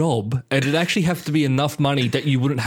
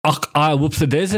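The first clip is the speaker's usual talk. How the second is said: put-on Scottish party piece; Scottish accent. Shown as a demonstration. Co-host asked for a bit of Scottish, showing off Scottish accent. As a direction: do your go-to Scottish catchphrase. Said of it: "give us a bit of Scottish".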